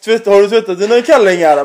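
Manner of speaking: loud, bus-driver announcement over the mic; mock bus announcement. Dad on the bus mic calling out his son loudly. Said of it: "ta mikrofonen och börja säga".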